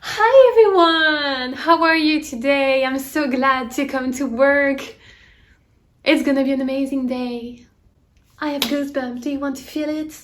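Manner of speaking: high, loud, slow overjoyed American coworker; super cheerful American. Retold or imitated. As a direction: beam with forced joy at everyone. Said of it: "vous voulez que je vous la fasse?".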